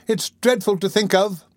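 high-pitched dread; shuddering. A narrator's reading.

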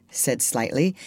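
usual speaking voice

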